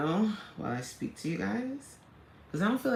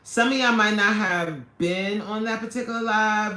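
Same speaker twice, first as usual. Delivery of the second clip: usual speaking voice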